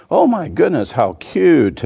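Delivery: high, gushing mock delight; imitating charmed audience. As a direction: gush like onlookers melting over a lamb. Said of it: "they'd be going".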